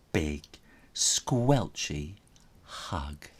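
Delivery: plain reading voice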